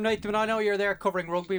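ordinary talk

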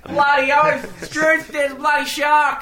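high, hammed-up Aussie shouting; drawn-out, high broad Australian accent; put-on Australian accent. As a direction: yell like an outraged mock Australian. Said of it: "the Australian with the Irish one".

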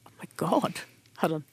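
choked, barely voiced whisper through tears; real tears breaking the voice; tearing up. Real, not acted. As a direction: stop yourself as the tears come. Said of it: "I've never actually teared up about this".

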